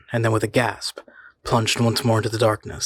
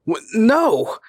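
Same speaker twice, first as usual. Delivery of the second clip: high, loud horrified shout; 12 semitones higher, 4 dB louder than usual. A narrator's reading. The man refusing the voice, a loud, high shout. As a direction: cry it out in horror. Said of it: "he shouted".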